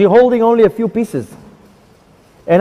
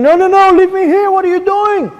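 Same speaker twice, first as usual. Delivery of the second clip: screaming and begging; 11 semitones higher than usual. Retold or imitated. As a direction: cry out not to be taken. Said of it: "I'm screaming, begging".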